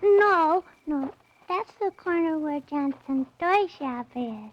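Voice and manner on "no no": louder insistent no; loud protest. Acted. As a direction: cut him off, insisting. Girl loudly protests.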